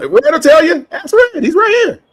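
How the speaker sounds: high, eager appeal to a witness; excited call-out. Real, not acted. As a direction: pull your friend in as proof. Calling out to Red.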